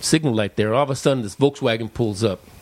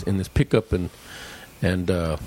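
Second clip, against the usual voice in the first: normal talking voice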